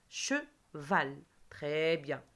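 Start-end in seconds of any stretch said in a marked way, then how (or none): 0.1-1.2 "Cheval" split as che, then val; "Cheval" in two detached syllables. Shown as a demonstration. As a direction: clap out "Cheval" in two separate beats. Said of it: "on va taper deux fois".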